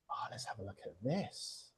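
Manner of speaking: soft breathy aside to herself; whisper. Real, not acted. Host peeking at the pose photo, whispered. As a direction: whisper it as you peek.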